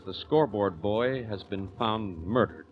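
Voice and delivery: ordinary delivery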